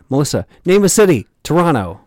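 loud, fast and high; hype-man call-out. Pretend. Mimicking city-listing DJ bit.

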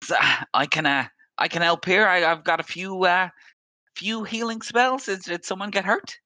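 high, bright-pitched offer of healing; dwarven priestess. Acted. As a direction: step in eagerly offering spells, pitched well up. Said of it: "she comes and goes".

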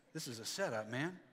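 low, soft, half-hearted mumble; unconvinced. Shown as a demonstration. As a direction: say it as if you don't believe it. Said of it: "don't say it like you're convinced".

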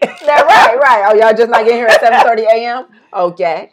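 hammed-up scold of the late ones; mock scolding. Woman playfully scolds sisters for arriving late.

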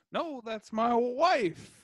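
high, drawn-out protest in a put-on cartoon voice; mock Popeye. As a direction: stretch it out high and slow, mock-offended. Voices Popeye defending his wife.

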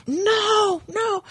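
high, crying "no, no"; inner alarm cry. Retold or imitated. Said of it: "my red flags ... went screaming like".

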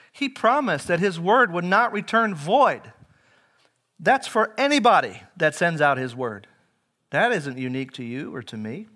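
emphatic pulpit proclamation; impassioned preaching. Real, not acted. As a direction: preach it loud and high to the congregation. Preaches at full force.

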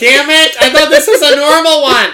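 loud frustrated outburst; exasperated shout. Real, not acted. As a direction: yell it in mock despair. Mock outrage at dark show.

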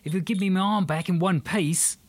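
quickly giving in, Australian; panicked Australian. Acted. Aussie man pleads.

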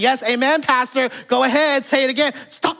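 high, bright church-lady calls; lightly voiced old church lady egging on the pastor; great-grandmother calling out amen in church. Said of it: "she'd be saying".

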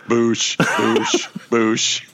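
Boushh repeating like a skipping record; stuck record. Retold or imitated. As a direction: loop the word like a worn groove. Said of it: "when the record runs out of groove, it just goes".

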